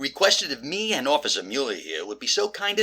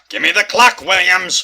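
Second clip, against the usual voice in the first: loud, forceful, raised-pitch order; shouted demand; 5 semitones higher, 10 dB louder than usual. Acted. Detective to thief with the clock, shouting. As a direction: demand it at full volume.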